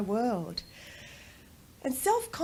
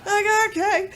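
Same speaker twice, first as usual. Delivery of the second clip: higher, louder, laughing through the words; 11 semitones higher, 6 dB louder than usual. Real, not acted. Mother laughs telling how she accepts son's no, laughing. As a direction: laugh as you give in.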